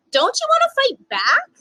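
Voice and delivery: a friend egging him on, pitched far up; urging friend. Acted. A friend challenging him after the bombing, high and urgent. Someone urges him to retaliate.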